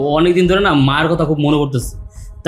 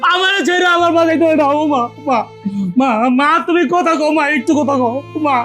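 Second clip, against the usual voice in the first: wailing grief; 7 semitones higher than usual. Acted. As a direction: cry out to the dead mother. Son learns mother is dead.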